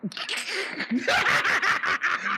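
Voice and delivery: amused high cackle; high laughing shout. Real, not acted. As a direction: crack up high. Laughing at rivals.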